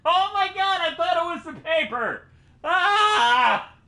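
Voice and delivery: high frightened screaming; terrified shout. Real, not acted. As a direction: scream in real panic.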